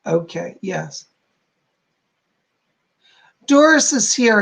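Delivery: ordinary talk, nothing marked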